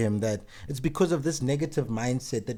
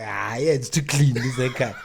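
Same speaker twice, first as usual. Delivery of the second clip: put-on Nigerian accent. Complimenting a friend's haircut in a light Nigerian accent. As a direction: praise it in a playful Nigerian accent. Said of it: "speaking in Nigerian accent".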